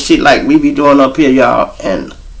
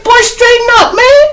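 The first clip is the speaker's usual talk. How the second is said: much higher scolding woman; high mock woman; 3 dB louder than usual. Imitating his friend scolding him while drunk, high woman's voice. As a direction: tell him to straighten up in her voice.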